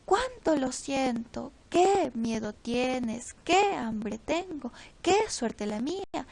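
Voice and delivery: exclamaciones lentas y enfáticas; emphatic exclamation. Shown as a demonstration. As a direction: alarga cada exclamación con énfasis. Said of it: "oración exclamativa o interrogativa". (exclamaciones lentas y enfáticas)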